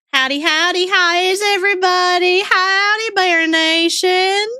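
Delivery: a cheery Southern howdy to the crowd; Southern accent. Pretend. Streamer greeting chat in a Southern accent as punishment. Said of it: "redeem Southern accent right away".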